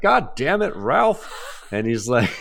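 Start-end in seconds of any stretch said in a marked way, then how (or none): 0.0-1.3 high exasperated yell at Ralph; annoyed camp counselor. Retold or imitated. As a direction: shoo off the town crazy. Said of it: "the other character's like".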